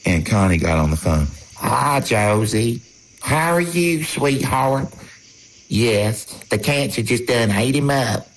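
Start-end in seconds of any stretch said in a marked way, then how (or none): 1.6-4.9 high, drawn-out Southern aunt voice; Aunt Connie. Retold or imitated. Aunt greeting her nephew on the phone, high and drawn out. 5.7-8.3 higher-pitched aunt on the phone; Aunt Connie. Retold or imitated. Aunt telling her nephew of the cancer, pitched up.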